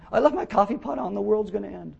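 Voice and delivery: high-pitched overdramatic crisis voice; hammed-up panic over nothing; mock panic. Pastor mimicking someone who makes everything a crisis, mock panic. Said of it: "someone who makes everything a crisis".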